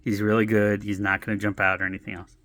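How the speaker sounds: low soft calm reply; calming the fussy lady, low and soft; placating. Retold or imitated. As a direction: soothe her down evenly. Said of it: "I'm like".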